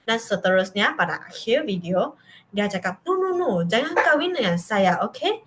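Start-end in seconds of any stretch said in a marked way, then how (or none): 3.1-5.4 high-pitched quoted no, no, no; high rising protest, imitated; high intonation. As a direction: lift the no's way up, mimicking. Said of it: "intonasi tinggi".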